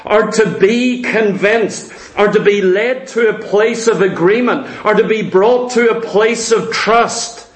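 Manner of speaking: ordinary delivery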